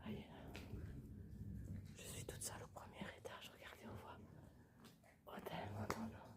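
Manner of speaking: soft unvoiced whisper throughout; library whisper. Real, not acted. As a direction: keep it hushed, exploring in the dark. Whispering while exploring the house.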